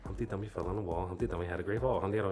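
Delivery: racing through a nursery rhyme; rapid-fire, run-together recital; too-fast reading aloud. Shown as a demonstration. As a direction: imitate a kid reading with no pauses. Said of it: "No, you're going too fast".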